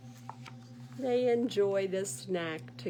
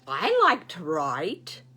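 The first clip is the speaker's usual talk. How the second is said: lower, loud, slow goose announcement; proud goose; 4 semitones lower, 9 dB louder than usual. Acted. Goose copying bear, loud slow proud declaration. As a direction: boom it out slowly, proud.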